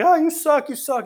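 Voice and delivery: put-on high, loud kid jeer; imitated heckler screaming from the stands; mock screaming heckle. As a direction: yell it like a mouthy teenage fan. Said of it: "He's still screaming at him".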